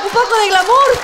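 loud laughing mock diva line; mock glamour. Punchline, mock posh demand.

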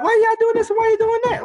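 high mocking busybody voice; mock disapproving onlookers. Mimics critics questioning them.

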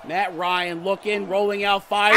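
usual speaking voice

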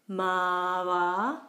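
"mava" with both vowels drawn out; both syllables long. Shown as a demonstration. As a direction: stretch each syllable of "mava". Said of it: "both syllables are long".

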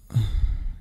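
short exasperated exhale; weary sigh. Acted. Grumbling alone after calculus class, weary sigh. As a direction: sigh at the workload ahead.

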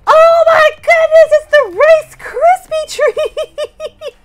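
high, loud squeal; thrilled at the find; loud delighted shriek; excited. Real, not acted. Finds Rice Krispie treat.

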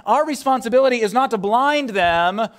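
ordinary talk

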